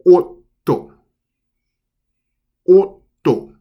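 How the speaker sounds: otto with a short stop held before the T; double consonant. Shown as a demonstration. Teacher repeating the double T for learners, careful demo. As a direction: otto with a clear pause in the middle. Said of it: "It's pronounced as number nine".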